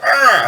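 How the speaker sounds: high, loud cry of cringe; re-enacted high recoiling "ah"; knee-jerk cringe. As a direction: yelp in instant disgust. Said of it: "instant knee-jerk reaction".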